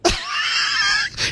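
squealing burst of laughter; cracking up at the co-host's joke; high shrieking laugh. Real, not acted.

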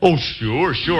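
ordinary delivery

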